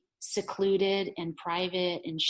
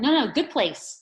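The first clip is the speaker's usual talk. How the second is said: loud, higher-pitched mock objection; acted protest; 6 semitones higher, 8 dB louder than usual. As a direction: object like a mother pushing for better. Mother's protest in acted quarrel.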